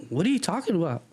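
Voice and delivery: high-pitched acted challenge; indignant disbelief. Teacher disputing with the cop, indignant high disbelief.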